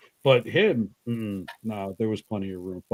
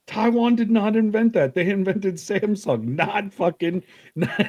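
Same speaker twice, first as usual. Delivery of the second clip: indignant correction; 11 semitones higher than usual. Retold or imitated. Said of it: "I'm like".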